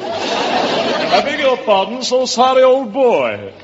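high, drawn-out English apology; English accent. Acted. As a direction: play the flustered English gentleman, accent hammed up. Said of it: "that's a wonderful English accent".

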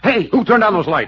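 loud, high demand; startled shout. Acted. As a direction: burst out, caught by surprise. Lights suddenly expose them sneaking out.

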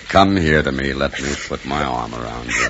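low, gentle, soothing voice; consoling. Acted. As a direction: comfort a crying child tenderly. Uncle comforts crying niece.

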